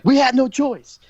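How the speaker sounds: high, loud mock protest; hammed-up high-pitched excuse; Nunzio pleading. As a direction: squeal it out like a cornered henchman. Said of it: "And Nunzio's like".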